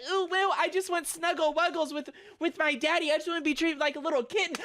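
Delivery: mock a needy fan, sugary and fast; mocking cutesy voice. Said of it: "It's not cute to be like".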